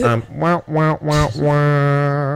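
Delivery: high, loud "wah wah" sting; mock sad trombone. Host punctuating the Jenners mention, mock trombone wahs. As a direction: voice a comic failure sting.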